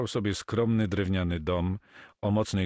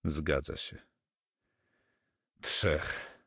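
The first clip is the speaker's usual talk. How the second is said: dead, voiceless "Trzech", falling; numb; 2 semitones lower, 5 dB quieter, much slower than usual. Acted. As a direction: drop "Trzech" lifeless and hushed. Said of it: "powiedział martwym głosem".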